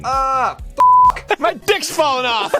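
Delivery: high strained cry of pain; hammed-up agony; mock pain. Character's crotch in pain.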